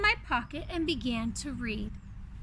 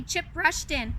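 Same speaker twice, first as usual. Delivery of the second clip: ordinary delivery, nothing marked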